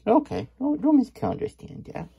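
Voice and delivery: high squeaky voice. Acted. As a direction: pipe it up high. Sudden high character voice.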